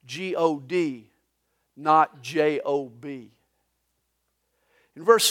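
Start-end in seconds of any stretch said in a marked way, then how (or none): 0.1-3.3 spelled out slowly. Shown as a demonstration. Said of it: "you spell the word God".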